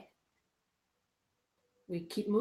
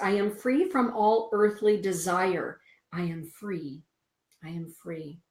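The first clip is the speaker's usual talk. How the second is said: reciting a mantra; 5 dB louder than usual. Acted. Reading the card's mantra to viewers, a fading recited chant. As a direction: intone it like a chant. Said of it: "The daily mantra is this".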